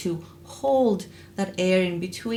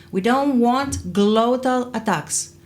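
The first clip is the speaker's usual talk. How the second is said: ordinary talk, nothing marked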